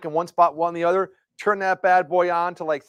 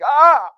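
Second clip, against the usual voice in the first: loud high yelp of pain; mock pained yelp; 11 semitones higher, 11 dB louder than usual. Son yelping when his sore legs are touched, loud. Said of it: "He's like".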